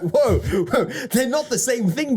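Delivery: high, drawn-out amused "whoa"; mock protest. Guest answering the man he quoted, in mock protest. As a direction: pull them up short, half laughing.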